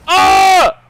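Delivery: sudden high loud yelp. Acted. Sudden yell.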